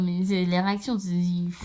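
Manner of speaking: ordinary delivery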